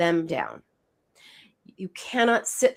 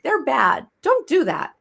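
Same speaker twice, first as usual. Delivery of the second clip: higher, louder mocking lecture; mock scolding culture voice; 6 semitones higher, 4 dB louder than usual. Host parodies cultural scolding, higher and louder. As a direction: scold like a preachy society. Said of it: "our culture says two things".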